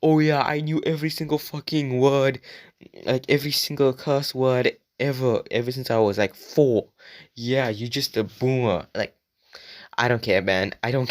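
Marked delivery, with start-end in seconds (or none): 0.0-8.9 mock a bragging commenter. Imagined commenter bragging about swear words, smug mock voice. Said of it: "don't go out in the comments and be like".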